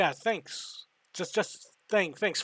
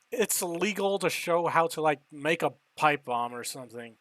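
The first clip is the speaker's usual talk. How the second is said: usual speaking voice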